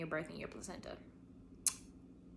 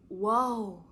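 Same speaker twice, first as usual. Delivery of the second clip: awed whoa; 7 dB louder than usual. Real, not acted. Recalling creating life.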